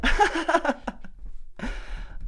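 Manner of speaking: airy startled laugh with no voice; nervous breathy laugh. Retold or imitated. Caught out by a stranger, a nervous breathy laugh. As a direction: let out a caught-off-guard laugh.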